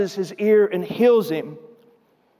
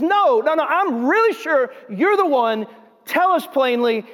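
higher-pitched, pressing bystander; insistent accusation; 6 semitones higher than usual. Acted. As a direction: cut off the denial and demand the truth. Another bystander presses Peter harder.

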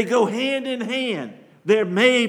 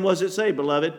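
ordinary talk, nothing marked

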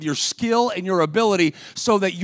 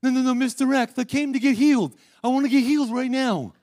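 put-on boy with a lisp; 6 semitones higher than usual. Pastor imitating the boy interrupting the meeting with a higher lisp. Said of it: "Had a little bit of lisp and a little bit of, like, nasal stuff".

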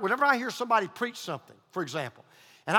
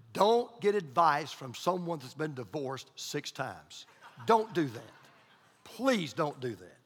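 ordinary delivery

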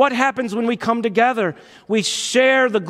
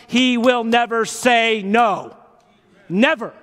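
preaching shout; 3 semitones higher, slower than usual. Real, not acted. Preacher to the church, a slow ringing shout. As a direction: lean hard into the promise.

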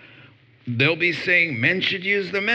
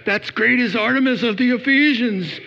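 mock fear; 9 semitones higher than usual. Congregation imagined afraid of offending pagans, high mock panic. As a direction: imitate a nervous churchgoer's alarm. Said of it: "You're so afraid like".

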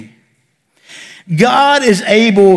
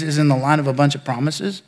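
ordinary talk

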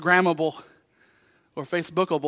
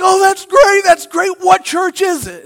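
much higher, louder gushing delight; excited stranger; 10 semitones higher, 8 dB louder than usual. Retold or imitated. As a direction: gush with delight at the news. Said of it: "He's like".

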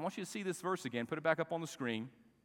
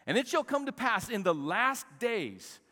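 loud, high scripture declamation; raised, emphatic preacher's reading; preach; 6 semitones higher, 5 dB louder than usual. Acted. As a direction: proclaim the prophecy from the pulpit.

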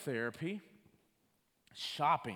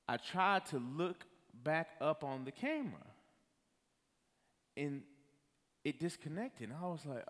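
ordinary talk

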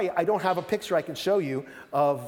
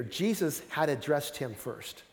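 usual speaking voice

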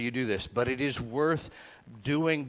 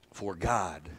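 slowed-down recitation; 5 semitones lower than usual. Shown as a demonstration. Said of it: "slow down and do this".

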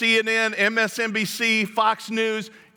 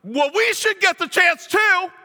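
much higher, slow, petulant demand; ticked-off whine; 12 semitones higher, 5 dB louder than usual. Acted. Disciples demanding their share, ticked off and whiny. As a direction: whine that it's their turn too. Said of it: "they were ticked off".

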